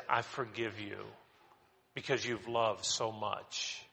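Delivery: a quiet, kind absolution; tender forgiveness. Retold or imitated. Jesus tenderly forgiving the weeping woman, softly.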